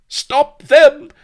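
very loud, high villain scream. A narrator's reading. Don Lardo orders the women gagged, screaming. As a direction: scream the orders at full force. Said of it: "screamed Don Lardo".

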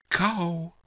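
high, breathless gasp of a word; a touch of breathless panting. Acted. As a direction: pant it out, dying. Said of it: "she panted".

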